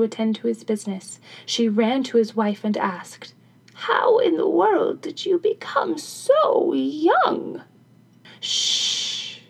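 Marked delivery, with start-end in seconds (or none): 3.7-7.6 put-on high, slow old woman's voice; amazed old crone. Crone asks her youthful sister, high amazed voice. 8.4-9.4 long voiceless "shh"; cautioning shush. A narrator's reading. Wife silences the old crone, cautioning shush. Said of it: "cautioned the wife".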